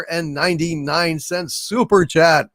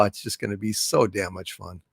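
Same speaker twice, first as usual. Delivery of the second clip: insincere; 8 semitones lower, 9 dB quieter than usual. Real, not acted. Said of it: "You, you don't sound sincere".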